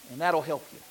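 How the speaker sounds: ordinary delivery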